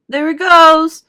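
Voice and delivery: high, loud excited shout. A narrator's reading. Onlooker spots the boy in the pipe, shouting. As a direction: shout it out to the crowd. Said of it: "somebody shouted".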